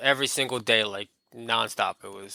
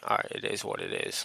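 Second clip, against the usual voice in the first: murmured shrug; 8 dB quieter than usual. Retold or imitated. Shrugging self-quote, unvoiced.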